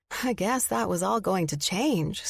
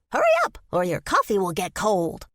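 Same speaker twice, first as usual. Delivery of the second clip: squirrel voice; 2 semitones higher, 4 dB louder than usual. Acted. Squirrel character voice.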